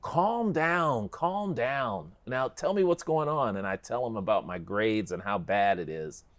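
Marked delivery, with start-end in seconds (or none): 0.0-3.6 calming dad. Retold or imitated. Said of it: "my dad is finally like".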